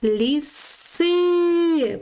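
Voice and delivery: "Ліси" with the final И high and long; stress on И in Ліси. Shown as a demonstration. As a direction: stretch and lift the last vowel of "Ліси". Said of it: "Наголос на и". (високий протяжний наголос на И у слові «Ліси»)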